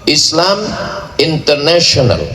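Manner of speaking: ordinary talk, nothing marked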